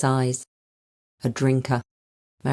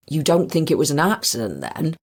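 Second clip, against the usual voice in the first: put-on West Country accent. A narrator's reading. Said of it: "the strong West Country accent".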